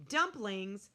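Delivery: ordinary delivery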